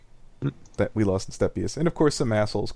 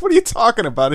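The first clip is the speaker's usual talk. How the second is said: indignant denial; 10 semitones higher, 5 dB louder than usual. Retold or imitated. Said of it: "he's like".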